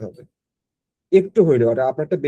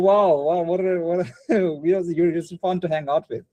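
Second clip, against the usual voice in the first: delighted reaction; 4 semitones higher than usual. Retold or imitated. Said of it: "everyone is like".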